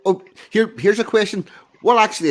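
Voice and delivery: excited jump-in; eager interjection. Real, not acted. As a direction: jump in with a bright new question. Sudden bright interjection.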